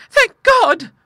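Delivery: very high, loud cry of thanks; fervent relief. A narrator's reading. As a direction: burst out in relieved gratitude. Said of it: "said Clara fervently".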